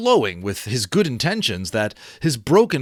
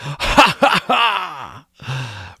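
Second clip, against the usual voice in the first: a rusty laugh set free; splendid laugh; 2 semitones higher, 4 dB louder, much slower than usual. Acted. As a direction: burst out in a big laugh. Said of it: "a splendid laugh".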